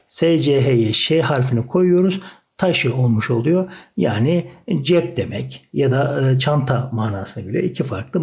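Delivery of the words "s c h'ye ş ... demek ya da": ordinary talk, nothing marked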